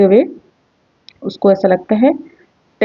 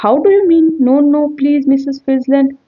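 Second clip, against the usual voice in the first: frightened pleading; 6 semitones higher, slower than usual. Acted.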